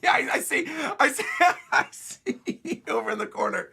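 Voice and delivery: helpless high giggling through words; real laughter breaking the sentence; laughing hard. Real, not acted. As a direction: try to talk while cracking up. Cracks up at the page.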